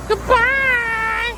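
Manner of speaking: put-on very high sing-song child's goodbye; high kid voice calling goodbye to the teacher; kids' goodbye. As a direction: chirp it like a little kid. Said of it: "usually the kids will say".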